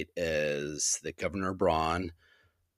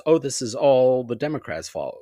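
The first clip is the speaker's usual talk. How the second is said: higher, louder mimicking of a brush-off; raised, mocking paraphrase; mock dismissive quote; 6 semitones higher, 6 dB louder than usual. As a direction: imitate someone waving it all away. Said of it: "They dismiss it as".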